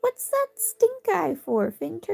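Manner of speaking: high, slow mock-scolding at the pet; teasing pet voice. Real, not acted. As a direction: tease the pet for his glare.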